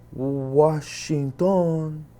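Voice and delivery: Washington drawn out very slowly, syllable by syllable; slow sounding out. Retold or imitated. Guard slowly deciphers the ID card word. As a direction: spell out Washington like a struggling reader.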